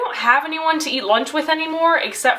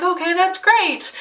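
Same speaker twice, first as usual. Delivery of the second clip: higher, drawn-out fake approval; sarcastic approval; 5 semitones higher, slower than usual. Girl retelling her reply to a group member, slow sarcasm. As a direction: stretch it out, falsely pleased. Feigned approval, she is annoyed.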